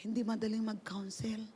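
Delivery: whispered aside. Real, not acted. Pastor confessing to the group that counseling is hard, whispered. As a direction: breathe it out with no voice.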